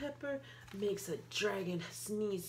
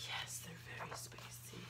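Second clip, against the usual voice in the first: soft whisper; hushed whisper; 12 dB quieter than usual. Acted. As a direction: breathe it out ominously. Grim confirmation.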